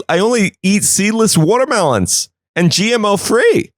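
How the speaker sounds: a high-pitched prissy officer whining about seedless fruit; picky officer voice. Acted. Picky officer refusing meth watermelons.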